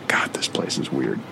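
low whispered aside to oneself; whispering. Acted. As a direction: murmur it so nobody hears. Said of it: "That's why I'm whispering".

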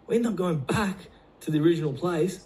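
ordinary delivery

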